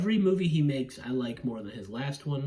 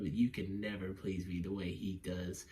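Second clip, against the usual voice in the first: low, soft put-on voice; mock taunting wife; 6 semitones lower, 7 dB quieter than usual. Reviewer playing the wife taunting her husband, low mock taunt. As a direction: imitate her taunt, dropped low and hushed.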